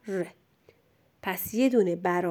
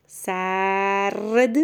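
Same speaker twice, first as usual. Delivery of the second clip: سرد hugely drawn out; slow dictation; much slower than usual. Shown as a demonstration. Teacher dictates for a pupil writing, very drawn-out. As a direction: stretch سرد, lingering on vowel and r.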